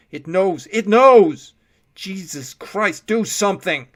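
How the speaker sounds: rising to a very loud, high scream of terror. A narrator's reading. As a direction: break into panic screaming. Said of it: "said with terror".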